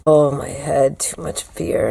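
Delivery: breathy, pained hangover mumble; hungover groan. Retold or imitated.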